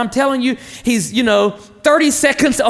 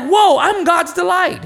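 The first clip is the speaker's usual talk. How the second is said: surprised whoa; 7 semitones higher than usual. Real, not acted. Amazed reaction to being God's delight.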